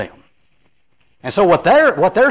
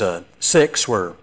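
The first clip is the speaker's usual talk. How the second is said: usual speaking voice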